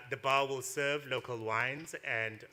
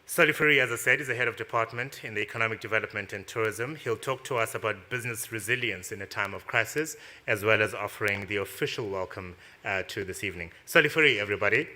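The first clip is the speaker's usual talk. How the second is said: announcer voice; 9 dB louder than usual. Acted. MC announces sponsors and speaker.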